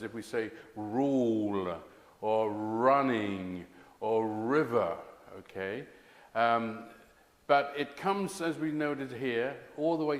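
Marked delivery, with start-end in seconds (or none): 0.0-5.0 "rule", "running", "river" slow, with a strong opening R; slow down and lean on the first R; slow initial R. Shown as a demonstration. Teacher showing R at word start slowly. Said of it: "particularly at the beginning of words".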